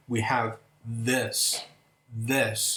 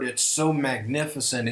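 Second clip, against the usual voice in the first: ordinary delivery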